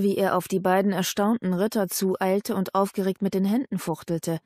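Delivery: plain reading voice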